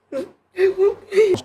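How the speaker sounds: loud, high, slow screaming sob; wailing sob. Real, not acted. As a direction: she breaks down howling. [name] sanglote encore. (hurlement en sanglots, aigu et lent)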